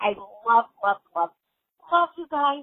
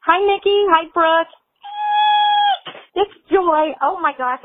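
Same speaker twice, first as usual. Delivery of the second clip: much higher and louder gushing hello; excited loud greeting; 8 semitones higher, 9 dB louder than usual. Real, not acted. Fan greeting both hosts on voicemail, thrilled and loud.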